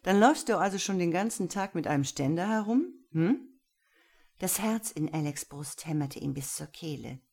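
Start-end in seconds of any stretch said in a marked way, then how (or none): 0.0-3.6 quick, higher, loud taunting question; amused teasing. A narrator's reading. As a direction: tease them, ending on a questioning hm. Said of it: "unterbrach Kayen belustigt". (belustigt stichelnd)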